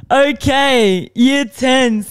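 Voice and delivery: lightly voiced gym teacher calling the class to order; mock teacher.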